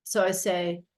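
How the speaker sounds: ordinary talk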